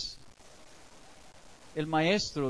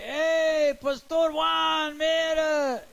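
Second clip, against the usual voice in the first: much higher, loud call with a long drawn-out Pastor Juan; neighbor calling out; 9 semitones higher, 4 dB louder, much slower than usual. Retold or imitated. Young neighbor mockingly hails the pastor from behind, high and loud. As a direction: yell after him from down the street.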